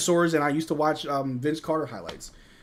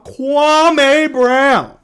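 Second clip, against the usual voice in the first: bellowed Kwame Brown, much higher and louder, dragging out Kwame; Stephen A impression; 11 semitones higher, 16 dB louder than usual. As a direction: yell Kwame Brown like a TV pundit mid-rant. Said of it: "sounds like Stephen A talking about".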